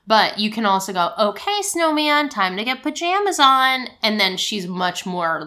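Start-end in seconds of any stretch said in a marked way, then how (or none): 1.2-3.9 higher, bright bedtime order; cheery parent instruction. Retold or imitated. Mom telling the snowman to get pajamas on, higher and cheery. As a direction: sing it out to get them moving.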